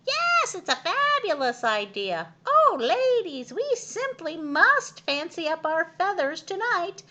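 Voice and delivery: high, loud, gushing hen voices; thrilled hens. A narrator's reading. Hens delighted at the glamorous plan. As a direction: gush like vain ladies.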